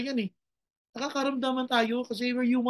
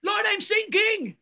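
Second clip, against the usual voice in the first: high, slow, loud shout to the Lord; desperate cry; 9 semitones higher, 3 dB louder, slower than usual. Acted. Preacher modeling a cry to the Lord for the congregation. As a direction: cry out like someone going under. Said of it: "Dapat ganyan ang iyak natin".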